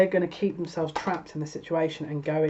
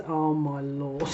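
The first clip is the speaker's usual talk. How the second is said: ordinary talk, nothing marked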